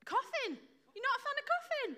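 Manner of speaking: high, incredulous echo of the answer; amazed check with the audience; playful disbelief. Real, not acted. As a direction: pitch it way up in mock surprise.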